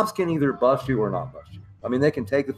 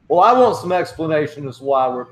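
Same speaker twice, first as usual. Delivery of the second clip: preppy college lawyer guy; 5 semitones higher, 7 dB louder than usual. Retold or imitated. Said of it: "trying to be like a preppy college lawyer guy".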